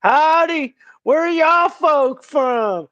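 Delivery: high, slow country drawl; mock Southern greeting. Friend imitating a West Virginia store clerk, high slow drawl. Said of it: "they're like".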